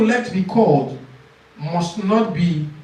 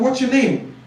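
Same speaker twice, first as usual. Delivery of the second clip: examiner asking; 7 semitones higher than usual. Shown as a demonstration. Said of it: "Then another one".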